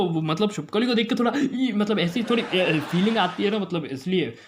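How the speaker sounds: normal talking voice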